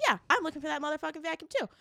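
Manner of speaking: high, rapid echo of the question; imitated fellow shopper. Other lady answers a fellow shopper, imitated fired-up reply.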